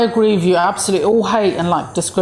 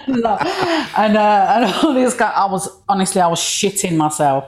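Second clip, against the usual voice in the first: ordinary talk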